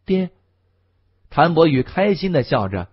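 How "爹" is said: a hint of a shy boy; small, hesitant call to a father; timid. A narrator's reading. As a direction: timidly greet your father, understated. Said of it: "少年怯生生地叫道".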